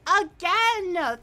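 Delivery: loud, high thrilled repeat; excited emphasis. Real, not acted. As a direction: shout it with delight. Repeat donation thanks.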